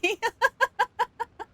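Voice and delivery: real high-pitched run of giggles. Real, not acted. Streamer laughs at own misreading, high giggle.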